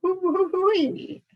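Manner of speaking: high, slow quavering hoots; shivery owl hoot. Acted. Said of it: "you hoot in that shivery".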